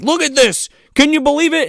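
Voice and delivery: high, eager goofy voice; mock excited hero. Hero showing the captain a clock, high and eager. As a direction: sell it like a kid showing off. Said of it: "He's like".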